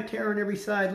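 terror on every side parroted high and scornful, words tossed back; mocking crowd parroting the prophet; mocking echo. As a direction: sneer the prophet's warning back at him. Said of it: "they're like".

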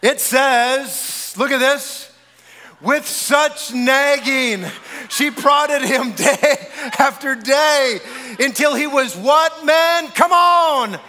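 loud, high, drawn-out preaching; rousing preacher shout. Real, not acted. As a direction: whip up the men with a booming call. Rousing the men over nagging verse.

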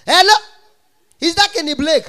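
very high, very loud phone greeting; shrieking caller from Anambra; loud hello. Retold or imitated. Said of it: "her voice no dey loud like that".

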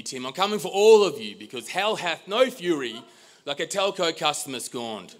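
high-pitched, hammed-up defiance; defiant vow. Customer scorned vowing he is coming for Tim, theatrically defiant. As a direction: declare war in mock-epic outrage.

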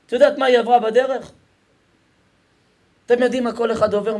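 loud, high-pitched sermon delivery, hammering each line; rally the crowd like a fiery preacher; preaching at full force. Acted. Rabbi opening his lesson to the congregation, preaching at full force.

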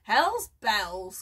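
loud, gleeful exclamation; triumphant cry. A narrator's reading. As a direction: crow it out in victory. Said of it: "cried the man triumphantly".

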